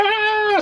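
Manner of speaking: long high "aah" in retelling; mock scream. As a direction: mimic a frightened girl's shriek. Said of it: "She was screaming".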